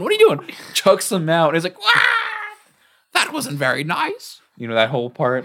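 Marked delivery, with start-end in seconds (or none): 0.0-0.5 very high squeal of protest; squeaky goblin. Retold or imitated. Small goblin inside the robot, very high squeal. 1.8-4.4 very high yelp, then a sulky complaint; hurt goblin. Retold or imitated. Goblin thrown from the robot, very high yelp and complaint.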